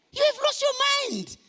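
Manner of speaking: wife scolding her husband, mock outraged; wife's outburst. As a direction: throw up her disbelief at him. Wife's shocked reaction retold.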